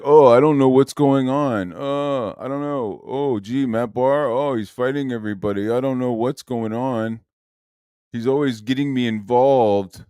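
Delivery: mock him as a clueless innocent; mocking whiny innocence. Streamer mocks Ethan's excuses in a whiny clueless voice.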